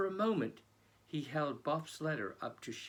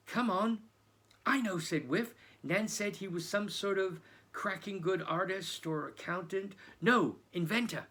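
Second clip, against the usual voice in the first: high, loud bursts of eagerness; excited; 7 semitones higher, 5 dB louder than usual. Acted. Two boys suddenly remembering, excited and louder. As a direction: blurt it out as it clicks.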